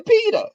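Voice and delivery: "Peter" as pita, pitched very high; grandmother's pronunciation. Retold or imitated. Retelling how grandmother kept talking, "Peter" as pita. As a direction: end "Peter" on uh with no r. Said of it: "she would say".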